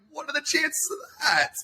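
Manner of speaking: very high, put-on amazement; high-pitched sarcastic wonder; mock surprise. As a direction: play along with a fake coincidence. Mock surprise at staged coincidence.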